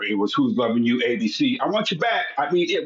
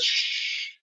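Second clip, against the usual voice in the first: a sharp whispered shh; shushing whisper; 5 dB quieter than usual. Retold or imitated. Mother hushing the kids as the phone rings, whispered. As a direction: hush the kids mid-rehearsal. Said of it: "my mother says".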